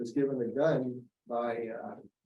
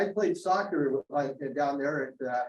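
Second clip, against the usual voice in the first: ordinary talk, nothing marked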